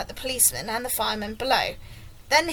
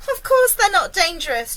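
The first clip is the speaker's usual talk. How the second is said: put-on high, loud boy's answer; called-back reassurance; 6 semitones higher, 6 dB louder than usual. A narrator's reading. James answers the police chief, calling back. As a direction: dismiss the worry brightly and loudly.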